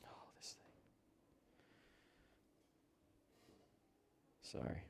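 breathy whisper off mic; muttered aside about the mic. Real, not acted. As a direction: whisper it to yourself. Aside about microphone trouble.

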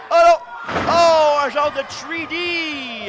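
slow, high, loud call; excited drawn-out call. Acted. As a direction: stretch the cry out in awe. Big move drawn out.

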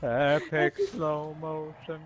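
slow, stretched-out movie slow-mo; mock slow motion. Player announces slow motion to his friends, mock slow motion. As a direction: drag the words out as if slowed down.

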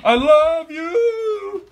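high, very slow teasing; teasing grandson sings it to his furious grandma; drawn-out sing-song. Real, not acted. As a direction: stretch it out sweetly to tease her. Grandson placating angry granny.

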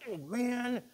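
mock dismay. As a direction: play the let-down prisoner. Acted prisoners grumbling at Paul.

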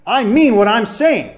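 a parent who has had enough; stern warning. Retold or imitated. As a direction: bang the table and mean every word. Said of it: "and the person goes".

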